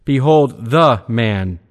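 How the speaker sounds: "the" punched, said as "thuh", before a falling "man"; a firm "Behold", then emphatic "the", pronounced thuh; stress on "the". Shown as a demonstration. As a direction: hit "the" hard as a separate, weighted word. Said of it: "be read with emphasis".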